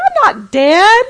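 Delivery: high, loud, drawn-out protest; incredulous father. Retold or imitated. Dad answering his tearful wife, loud drawn-out disbelief. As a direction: imitate a dad astonished at the idea.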